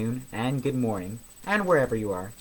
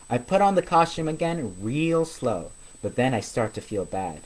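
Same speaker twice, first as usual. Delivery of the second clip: usual speaking voice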